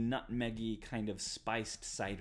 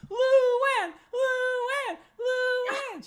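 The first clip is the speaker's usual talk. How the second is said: very high, very loud chant, fading a little; shrieking the name to the whole tavern; screaming a name; 15 dB louder, much slower than usual. Acted. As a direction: scream it across the room to everyone. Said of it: "screams to everybody".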